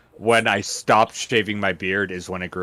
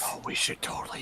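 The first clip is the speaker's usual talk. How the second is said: fast excited whisper; 9 dB quieter, much faster than usual. Real, not acted. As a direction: rush it out in a whisper. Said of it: "Why are we whispering?".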